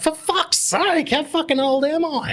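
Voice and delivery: high, loud exasperated cry; exasperated outburst. Retold or imitated. Feeling old as clients miss references, loud. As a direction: throw up your hands, loud and way up high. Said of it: "And I'm like".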